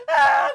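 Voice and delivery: high, loud mock scream; panicked scream. Child with head stuck, screaming in panic. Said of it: "freaking out".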